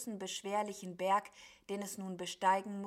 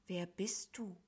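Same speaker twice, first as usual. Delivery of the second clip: soft, subdued question; a touch of gloomy puzzlement, kept quiet; gloomy astonishment; 5 dB quieter than usual. A narrator's reading. Cloud beast looks down at the stranger, softly gloomy. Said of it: "schaute erstaunt und trübsinnig nach unten".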